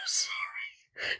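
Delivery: whispered sorry. Real, not acted. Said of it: "Oh, that's mean".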